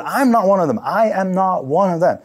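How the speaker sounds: loud, high insistent denial; panicked denial. Acted. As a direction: deny it hard out of fear. Peter denies again, panicking.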